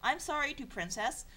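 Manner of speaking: ordinary talk, nothing marked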